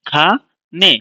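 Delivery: খা, a clear pause, then নে; pause between syllables. Shown as a demonstration. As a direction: separate খা from নে with a gap. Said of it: "pause দিই".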